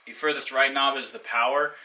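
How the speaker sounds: normal talking voice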